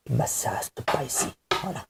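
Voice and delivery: half-whispered aside; odd hushed voice. Real, not acted. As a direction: drop to a voiceless murmur. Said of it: "Pourquoi je parle comme ça".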